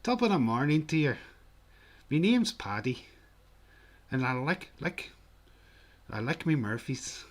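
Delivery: loud, high, lilting stage Irish; Irish accent. Shown as a demonstration. Streamer doing the old Murphy advert, hammed-up Irish accent. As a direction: open big like an Irish advert. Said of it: "I always have to start the Irish".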